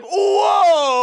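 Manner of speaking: loud, high gasped whoa; mock shocked whoa. The group reacts to the insult, a loud shocked whoa. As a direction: react like the room just exploded.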